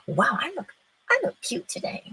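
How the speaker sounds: high, soft little self-compliment; pleased self-admiration. Acted. Praising yourself in the mirror, high and pleased.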